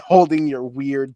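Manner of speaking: ordinary delivery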